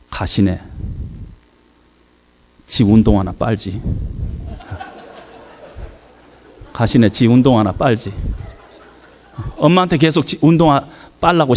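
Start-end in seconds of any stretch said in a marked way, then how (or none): 0.1-8.1 low Jeolla-dialect aside with long pauses. Retold or imitated. Mother in the speaker's ear during the hymn, low Jeolla-dialect grumble. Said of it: "전라도 사투리로".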